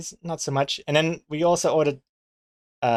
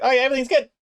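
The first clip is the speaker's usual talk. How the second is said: much higher, bright put-on "all fine" voice; fake cheery reassurance; 11 semitones higher, much faster than usual. Brushing off a wrong order with the owner, overly cheery. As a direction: sell it like everything's perfect when it isn't. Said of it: "so I was like".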